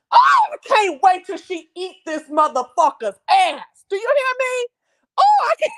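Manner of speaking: high loud delighted squealing; excited high whoops; gleeful squeal. Real, not acted. Gleeful anticipation of revenge.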